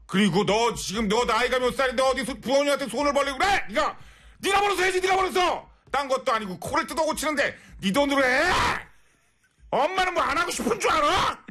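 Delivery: high-pitched scolding mother rant, shouting; scolding mom. Pretend. Mother scolding her daughter over nose surgery, shrill yelling. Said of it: "엄마 입장에서".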